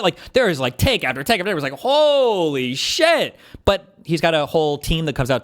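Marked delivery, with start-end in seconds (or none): 1.7-3.4 high, long-drawn astonished exclamation; amazed reaction. Retold or imitated. Debater recalling take after take of a rival's clips, drawn-out amazement. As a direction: stretch it out high, in mock awe.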